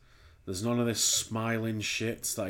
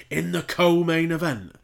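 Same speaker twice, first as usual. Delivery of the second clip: announcer voice; 7 semitones higher, 7 dB louder than usual. Retold or imitated. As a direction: announce it like a hype reel, higher and louder. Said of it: "it's like".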